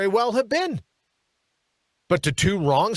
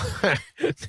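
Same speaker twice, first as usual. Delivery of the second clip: breathy laugh; 3 semitones lower than usual. Real, not acted. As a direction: crack up mid-sentence. Breaks into laughter.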